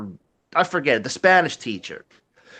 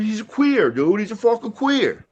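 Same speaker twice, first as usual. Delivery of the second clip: higher-pitched mock complaint; imitated exasperation; 7 semitones higher than usual. Sam complains to his friend about his stepson, mock exasperation. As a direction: play the fed-up dad, lightly hammed up. Sam's exasperated remark, acted.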